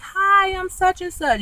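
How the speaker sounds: high, slow sing-song hello; mimicking a bubbly introduction; mock cheery greeting. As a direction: chirp it brightly, stretched out. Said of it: "was like".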